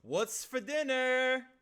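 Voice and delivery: a greedy schemer rubbing his palms; villain eyeing the mother across the dinner table; creepy villain. Acted. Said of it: "rubbing my palms together and say".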